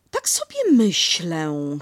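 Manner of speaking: drawn-out pondering Kanga; thoughtful voice. Acted. Kanga musing over Piglet's bath, slow and thoughtful. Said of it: "głosem pełnym zastanowienia".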